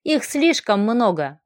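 usual speaking voice

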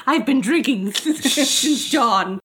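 slow slurred boozy priest; drunk priest voice. Retold or imitated. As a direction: drag it out like a drunk. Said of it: "He's like".

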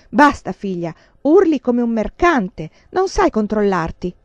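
loud, firm rebuke of a daughter; stern scolding aunt. A narrator's reading. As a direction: clap and cut her off sharply.

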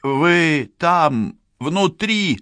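high-pitched call through a door; yelling. A narrator's reading. Policewoman calling to the killer inside, yelling. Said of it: "заорала Францискович".

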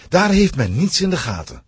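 ordinary delivery, nothing marked

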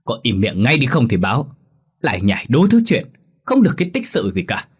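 angry scolding. Acted. Village chief scolding gossiping villagers, angry yell. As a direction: bark at the gossiping crowd to shut up. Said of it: "thì quát lớn".

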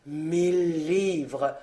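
low, very slow repetition; mechanical. A narrator's reading. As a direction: repeat it mechanically, drawing it out slowly. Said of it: "répéta-t-il machinalement". (machinalement, d'une voix lente et basse)

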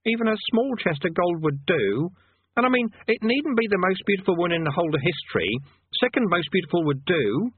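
a hint of pleading, high conjurer. Conjurer bargaining with Rincewind, a touch of longing. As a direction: beg a little, growing wistful. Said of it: "edge of pleading in his voice".

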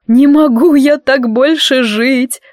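slow, loud bass sob; sobbing in a bass. Acted. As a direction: wail it out in a bass voice. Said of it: "прорыдала гостья басиком".

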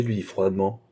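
usual speaking voice

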